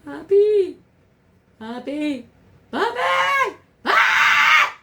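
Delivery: high, loud scared yell for papi; calling for dad. Retold or imitated. Terrified child screaming for his dad, high and loud. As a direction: shout for your father in fright. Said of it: "my father would come".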